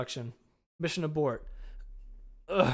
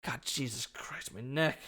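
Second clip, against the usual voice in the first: pained groan; 3 semitones lower, 8 dB quieter, slower than usual. Acted. Hurt after landing.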